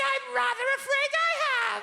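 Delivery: very high, polite little voice; prim housewife. Retold or imitated. Pupil greeting her teacher from the cupboard, high and prim. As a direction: chirp it out primly from the cupboard. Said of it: "like a repressed nineteen forties housewife".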